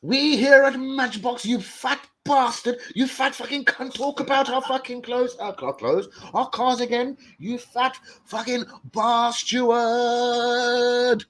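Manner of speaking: high, slow mock-outraged company voice; high, slow mock rant, last line sung; mock angry brand. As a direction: play Matchbox's furious reply, hammed up. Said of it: "Tomorrow I'm gonna wake up to".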